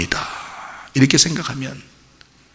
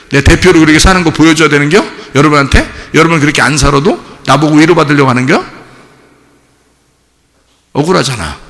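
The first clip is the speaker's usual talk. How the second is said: usual speaking voice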